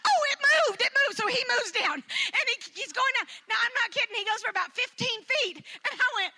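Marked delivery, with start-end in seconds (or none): 0.1-1.2 high, louder, hurried alarm; urgent excitement. Retold or imitated. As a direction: warn him the fish is slipping away. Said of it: "and I'm like".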